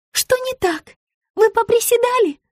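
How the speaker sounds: very high, sugary questioning; cooing concern. A narrator's reading. Rita checks on her client's squats, cooing. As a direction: ask sweetly in a tiny high voice. Rita's sweet voice continues.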